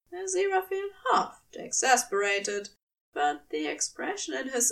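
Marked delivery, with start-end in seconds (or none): none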